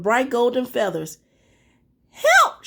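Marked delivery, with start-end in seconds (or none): 2.1-2.6 high clucking cry for help; clucking hen. Acted. Hen begging Jack for help, high clucking. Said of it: "she clucked".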